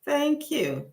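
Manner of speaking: high, gushing thank you; delighted thanks. Real, not acted. Host thanking a viewer for a gift in the chat, high and delighted. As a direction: squeal the thanks out of surprise.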